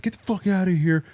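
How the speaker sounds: high-pitched retold outrage; pissed off. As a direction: imitate an exasperated prank victim. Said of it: "he'd get pissed again".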